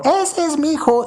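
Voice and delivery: very high-pitched proud mother shriek; mom yelling from the crowd. Retold or imitated. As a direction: cheer him on from the crowd, way up high. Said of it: "la voz de su mamá gritando". (grito agudo de mamá orgullosa)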